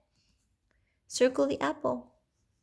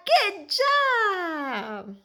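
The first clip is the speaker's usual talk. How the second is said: high cheerful praise; 9 semitones higher than usual. Real, not acted. Praising toddler.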